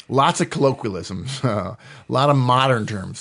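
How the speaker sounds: ordinary delivery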